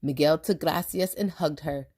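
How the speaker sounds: plain reading voice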